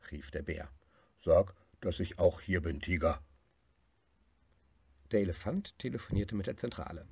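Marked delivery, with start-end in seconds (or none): 1.2-3.2 nudging his friend; bear voice. Acted. Bear asks tiger to mention him.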